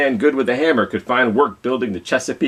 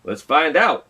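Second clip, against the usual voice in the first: John Henry; 7 semitones higher, 3 dB louder, slower than usual. Acted. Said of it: "John Henry answered".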